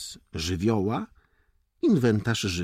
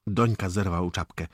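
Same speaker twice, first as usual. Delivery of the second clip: ordinary delivery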